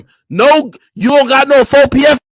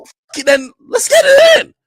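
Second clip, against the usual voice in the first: high, shouted hype cry. Retold or imitated. A rapper shouting an eager challenge to fight. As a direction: yell it like a hyped-up challenge.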